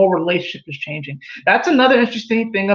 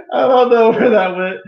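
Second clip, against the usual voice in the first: said through laughter, louder; laughing; 4 semitones higher, 5 dB louder than usual. Real, not acted. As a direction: laugh while admitting the tangent. Laughing at own tangent.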